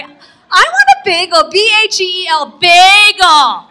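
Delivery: put-on loud, high, slow child's demand; mock toddler shouting for a bagel; whiny kid yell. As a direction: stamp your foot like a toddler. Child insists louder.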